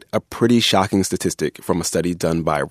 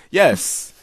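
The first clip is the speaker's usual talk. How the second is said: usual speaking voice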